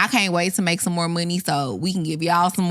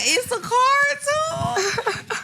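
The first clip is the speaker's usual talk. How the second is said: tearful surprise; much slower than usual. Real, not acted. Sees birthday card, overwhelmed.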